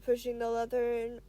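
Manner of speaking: ordinary delivery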